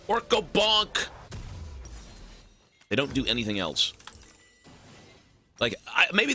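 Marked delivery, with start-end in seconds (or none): 0.1-1.1 high, slow caveman grunt of a voice; dumb orc. Pretend. Streamer voicing a dumb orc in a slow, high put-on voice. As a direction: play a dim brute saying it simply.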